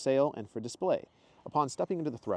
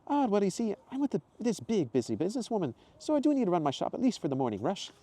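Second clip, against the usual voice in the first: much higher, louder cheerful woman's voice; Zoe's exclamation; 11 semitones higher, 3 dB louder than usual. Acted. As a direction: greet him brightly and high. Zoe voiced.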